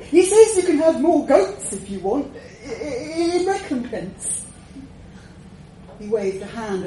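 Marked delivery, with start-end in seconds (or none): 0.1-4.5 high, loud, stammering offer; frightened underling placating a dragon; nervous messenger. A narrator's reading. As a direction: blurt it out high, then falter.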